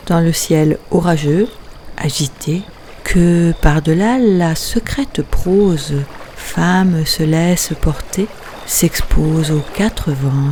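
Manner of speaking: measured poem reading, phrase by phrase with pauses; poetry recitation. Acted. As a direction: recite the poem line by line, letting each phrase stand apart. Recites her poem Tumulte.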